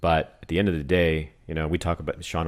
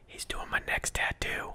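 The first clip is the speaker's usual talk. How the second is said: hushed secret, barely any voice; whisper; 9 dB quieter than usual. Real, not acted. Announcing a secret to the audience, whispered. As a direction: lean in and whisper the news.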